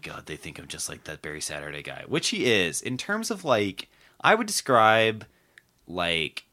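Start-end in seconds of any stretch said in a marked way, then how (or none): 0.0-2.0 low, hushed mock fretting; quiet, low mock lament; imagined troubled candidate. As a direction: fret under your breath, imitating a sleepless politician. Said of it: "he's like, he's like".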